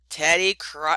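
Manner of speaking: ordinary delivery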